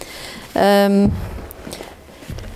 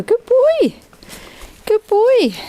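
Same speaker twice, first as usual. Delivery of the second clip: high praise coo; 13 semitones higher, much slower than usual. Real, not acted. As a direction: gush over a good pony. Praising horse.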